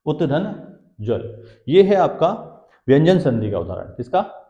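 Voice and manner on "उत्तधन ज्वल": उत्तधन और ज्वल slowly, as two separate pieces; slow split into parts. Shown as a demonstration. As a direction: break the word into उत्तधन, then ज्वल. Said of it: "ये शब्द बना है दो शब्दों से मिलके". (शब्द को धीरे-धीरे दो टुकड़ों में बोलें)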